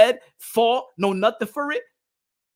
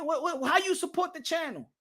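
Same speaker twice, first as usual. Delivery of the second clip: ordinary talk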